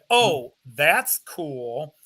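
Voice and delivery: usual speaking voice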